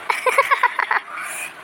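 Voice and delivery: high, voiceless giggling; laughter. Acted. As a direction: let out a run of high giggles. Laughter closing the skit.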